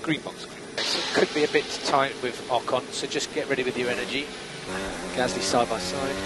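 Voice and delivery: usual speaking voice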